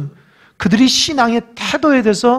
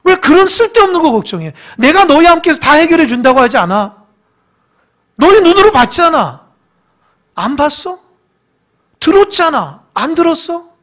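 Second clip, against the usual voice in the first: loud, higher preaching at full force; fiery preaching; 7 semitones higher, 6 dB louder than usual. Acted. Pastor voicing Jesus to the congregation, loud and fervent. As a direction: drive it home to the congregation.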